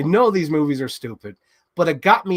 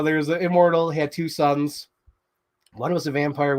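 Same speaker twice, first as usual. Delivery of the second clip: ordinary talk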